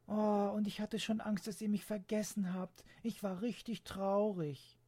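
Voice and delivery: put-on high girl's voice, relieved then a touch sad; the girl admits she was afraid they forgot; relieved young girl.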